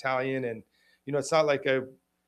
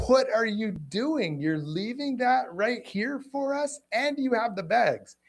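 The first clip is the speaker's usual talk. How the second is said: high, slow, drawn-out indignant challenge; indignant confrontation; 9 semitones higher, slower than usual. Retold or imitated. Confronting the dog owner who left the mess, indignant and slow. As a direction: spell out his offence slowly in disbelief. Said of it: "I'm like".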